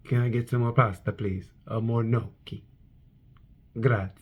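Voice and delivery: soft, low Italian-rider impression; hushed, low put-on voice; Luca Marini. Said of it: "And Luca Marini's like".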